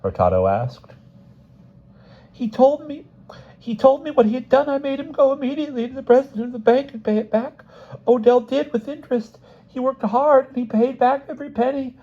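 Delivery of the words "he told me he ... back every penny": very high, insistent wife's voice; tearful outpouring. A narrator's reading. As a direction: defend your husband in a high-pitched rush of words. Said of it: "words flowed from Missus Smith like".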